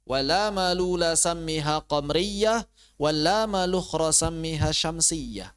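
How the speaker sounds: slow, drawn-out Arabic verse recitation. Shown as a demonstration.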